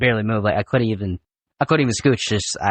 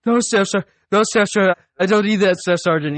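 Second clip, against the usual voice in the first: panicked pleading; 9 semitones higher than usual. Retold or imitated. Recruit begs staff sergeant not to get the rod, high panicked pleading. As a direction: plead like a recruit terrified of the punishment. Recruit begging off the silver bullet.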